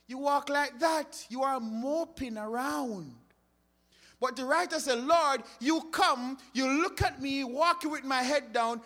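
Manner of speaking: fervent sermon delivery; preaching. Acted. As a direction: drive the point home to the congregation. Preaching at full force.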